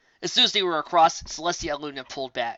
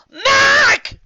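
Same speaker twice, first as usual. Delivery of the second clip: high, loud shout of a name; 14 dB louder than usual. Acted. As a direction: yell his name across the field. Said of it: "she shouted".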